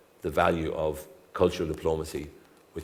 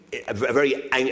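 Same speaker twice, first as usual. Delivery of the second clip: ordinary talk, nothing marked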